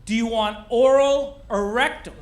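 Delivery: mock holler from one aisle over; imitated yell. Wife calls to husband in the next aisle, imitated yell. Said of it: "she yells over to me".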